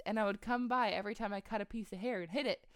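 ordinary talk

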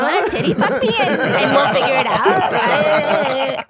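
very high, slow, giggly ditzy voice; dumb girl act.

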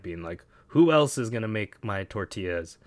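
ordinary talk, nothing marked

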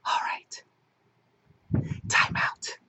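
sighing, voiceless whisper; strained voice. Real, not acted. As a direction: voice giving out, barely audible. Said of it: "as much of an explanation my voice can give today".

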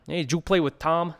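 put-on failed Boston accent, pitched high; a botched try at a Boston accent. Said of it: "that's not Massachusetts at all".

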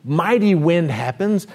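ordinary talk, nothing marked

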